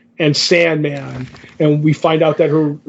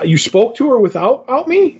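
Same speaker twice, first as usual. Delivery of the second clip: mock surprised question; 7 semitones higher than usual. Retelling a character left out of a conversation, mock surprised. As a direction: voice leaps much higher on the repeat.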